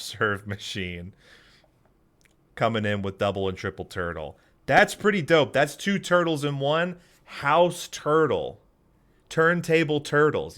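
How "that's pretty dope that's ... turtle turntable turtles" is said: loud, high and thrilled; proud burst of hype; excited. Real, not acted. Proud of the finished drawing.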